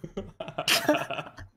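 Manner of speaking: breathy laugh. Real, not acted. Laughing at the joke.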